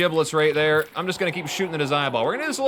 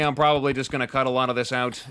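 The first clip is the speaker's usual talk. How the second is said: usual speaking voice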